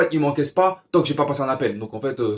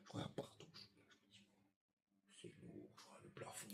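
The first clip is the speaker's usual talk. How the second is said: chuchoté; 34 dB quieter than usual. Retold or imitated. Émerveillement chuchoté.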